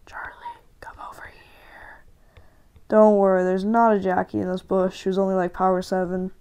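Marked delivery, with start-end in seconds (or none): 0.0-2.0 a hushed whisper. Real, not acted. Gamer whispering to teammate Charlie from the bush. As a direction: whisper to your teammate, stay hidden.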